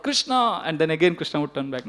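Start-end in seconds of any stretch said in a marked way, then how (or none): 0.0-0.6 high, falling call of a name; acted-out plaintive call. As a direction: imitate a mother calling after her son. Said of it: "she would call out".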